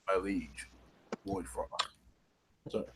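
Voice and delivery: low, soft troll voice, slow and deferential; reverent troll. Acted. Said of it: "he's a huge troll with a war hammer".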